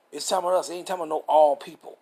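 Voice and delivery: ordinary delivery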